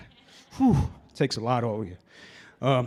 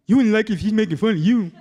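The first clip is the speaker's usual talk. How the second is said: higher, louder put-on driver voice; gruff bus driver; 5 semitones higher, 6 dB louder than usual. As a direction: bark back like the annoyed driver. Bus driver defending himself.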